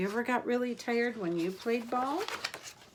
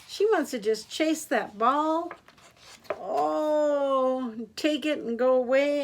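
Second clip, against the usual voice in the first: excited; 6 semitones higher, 5 dB louder than usual. Acted. Happy ending excitement.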